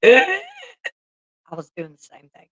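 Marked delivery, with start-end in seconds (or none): 0.0-0.4 a sudden high, loud imitated gasp-laugh; imitating a meme's gasping laugh. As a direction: mimic the toothless meme guy's wheezing gasp. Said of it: "that toothless guy in that one meme where he's just like".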